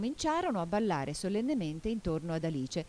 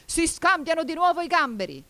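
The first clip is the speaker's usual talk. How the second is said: high, loud, excited shout; hollered dance call; 11 semitones higher, 7 dB louder than usual. A narrator's reading. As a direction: holler the next figure. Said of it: "vociò il grifone".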